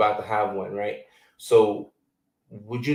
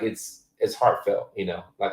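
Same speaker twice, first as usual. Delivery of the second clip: normal talking voice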